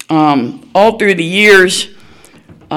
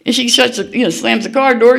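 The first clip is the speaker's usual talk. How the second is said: ordinary talk, nothing marked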